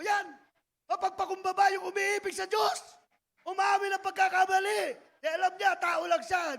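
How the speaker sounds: high and loud sermon cry; loud preaching. Real, not acted. Preacher proclaiming humility to the church, loud. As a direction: preach it hard.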